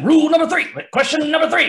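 high, loud showman genie turning the question into a rule. Retold or imitated. Guest mimics the host's question as the Aladdin genie, high and loud. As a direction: boom it out like the cartoon genie. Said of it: "you did go into genie territory".

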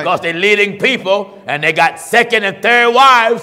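fiery preacher denunciation; preaching at full force. Acted. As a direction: preach hard against the sinners.

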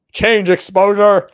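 slow, high, loud command. Real, not acted. Repeats a command louder and slower.

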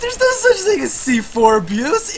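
loud laughing shout of disbelief. Real, not acted. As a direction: laugh off the accusation.

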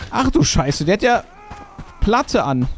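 alarmed exclamation. Real, not acted. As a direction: blurt it out in shock. Alarmed at armored enemy.

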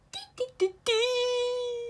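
high, soft sing-song error beeps; high quiet beeps. Pretend. Mocking the broken computer with soft high beeps. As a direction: chirp it softly like a machine.

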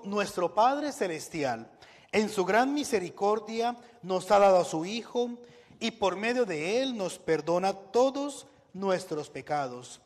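ordinary delivery

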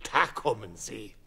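ordinary delivery

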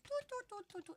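tiny, very soft toots; quiet demo. Shown as a demonstration. Player showing teammate how to tone it down, very quiet. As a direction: show how to speak softly in little toots. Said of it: "You need to kinda go like".

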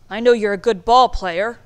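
loud gruff bark; loud, challenging bark. A narrator's reading. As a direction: bark it at him like a tough boss. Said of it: "Mister Rickey barked".

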